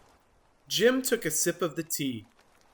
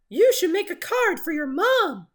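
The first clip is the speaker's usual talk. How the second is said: much higher, louder small voice; chirpy critter; 5 dB louder than usual. Acted. A small animal advising Jim. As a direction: suggest it sweetly.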